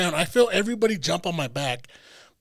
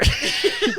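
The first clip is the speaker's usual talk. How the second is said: high laugh; 3 dB louder than usual. Real, not acted. Laughing at his own pain.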